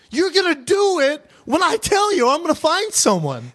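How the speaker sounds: mock indignant rant. Mock-ranting at cleaners.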